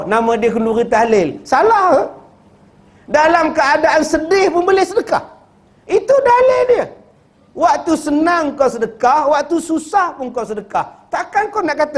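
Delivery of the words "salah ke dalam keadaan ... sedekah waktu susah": preaching at full force. Real, not acted. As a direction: hammer home the proof.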